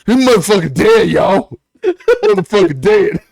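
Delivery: loud, high imitated outcry; hammed-up declaration of a death; mock alarmed announcement. Quoting a film death scene, loudly insistent.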